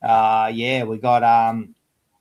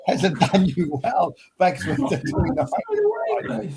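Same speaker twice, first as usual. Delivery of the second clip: laughing tease; 8 semitones higher than usual. Real, not acted. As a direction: tease a mate while cracking up. Teasing Manny, laughing mid-sentence.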